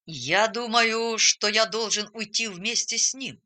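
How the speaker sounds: plain reading voice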